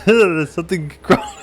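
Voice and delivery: high, loud words through real laughter; cracking up mid-sentence; helpless laughter. Real, not acted. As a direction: barely get it out for laughing. Cracking up over own voice.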